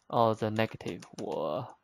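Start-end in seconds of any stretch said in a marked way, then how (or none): none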